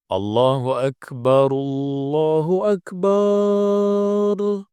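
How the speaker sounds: first Akbar short, final "bar" drawn out to the limit; overlong bar. Shown as a demonstration. Teacher imitates a muezzin stretching the last bar, high drawn-out chant. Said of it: "muadzin yang memanjangkan bar ini".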